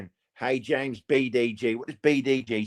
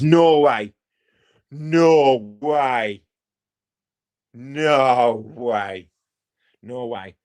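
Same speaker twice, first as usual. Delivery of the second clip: fading no way; 5 dB louder, much slower than usual. Acted. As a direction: start with a shout and let each one fade. Insisting he won't swear.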